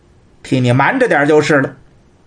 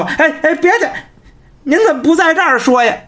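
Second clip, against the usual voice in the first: anxious pleading servant; 9 semitones higher, faster than usual. Acted. Cui Ying begs him to speak.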